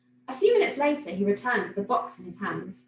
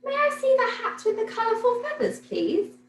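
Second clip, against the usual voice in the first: put-on high little girl voice; a polite small girl asking sweetly; much higher, a child's polite request; Millie's voice; 13 semitones higher than usual. A narrator's reading. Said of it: "she asked the man behind the counter".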